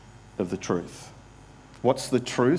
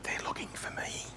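whisper; 15 dB quieter than usual. A narrator's reading. Hiding child answers the pastor.

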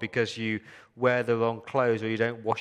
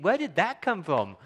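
startled inner voice; 8 semitones higher, 4 dB louder than usual. Retold or imitated. Voicing listener's inner thought.

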